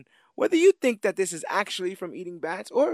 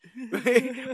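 giddy laugh; 9 semitones higher, 4 dB louder than usual. Real, not acted. Laughing at his own joke.

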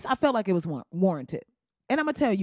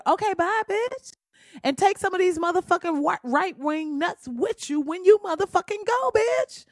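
sassy dismissal; 9 semitones higher than usual. Real, not acted. Comic rant at Roseanne.

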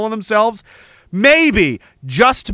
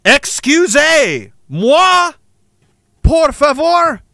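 slow, higher mock politeness; mock polite; 6 semitones higher, much slower than usual. A sarcastic aside after the Nazi insult, mock polite. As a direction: drawl it out with sarcastic courtesy.

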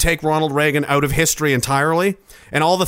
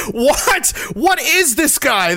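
baffled; 11 semitones higher, much slower than usual. Retold or imitated. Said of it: "You're like".

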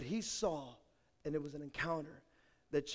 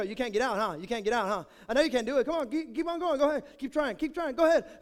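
louder, gloating teasing; mocking taunt; 4 semitones higher, 5 dB louder, faster than usual. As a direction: needle her to keep trying. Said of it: "I go".

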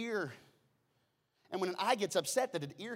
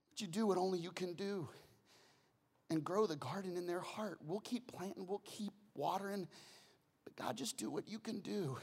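prayer. Acted. Prayer in mid-sermon.

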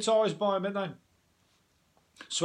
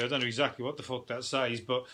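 normal talking voice

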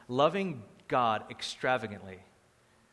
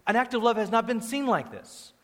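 usual speaking voice

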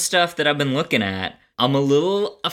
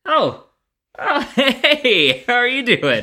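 mock surprised greeting; 12 semitones higher, 4 dB louder, slower than usual. Mock greeting a pretend newcomer.

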